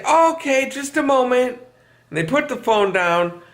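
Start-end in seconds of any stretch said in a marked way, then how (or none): 0.0-1.6 a hint of fake sweetness, a bit higher; mock polite answerer. Person at home telling the caller to hold, falsely polite. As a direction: sound nice to the caller for a second. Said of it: "And then they'll say".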